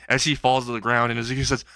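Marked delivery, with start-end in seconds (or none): none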